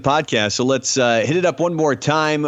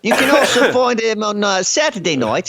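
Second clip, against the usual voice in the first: higher, hammed-up British accent; put-on British accent; 6 semitones higher than usual. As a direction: keep the fake British accent going on the plugs. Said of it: "gone from British to Australian".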